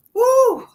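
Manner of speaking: high, loud celebratory whoop; joyful whoop. Real, not acted. Host cheering her livestream viewers, loud high whoop. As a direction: let out a big happy cheer.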